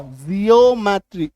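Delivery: "जियोमेट्री" slowly, ee then o; drawn-out vowel demo. Shown as a demonstration. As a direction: stretch "जियोमेट्री" to show the hidden ee-o. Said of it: "ई ओ". ("जियोमेट्री" धीरे, ई-ओ खींचकर)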